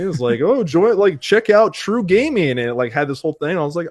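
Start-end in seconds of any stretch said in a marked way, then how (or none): 0.4-2.5 mock ad pitch. Imitating an ad pitch.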